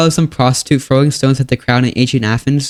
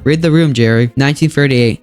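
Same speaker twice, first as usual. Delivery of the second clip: usual speaking voice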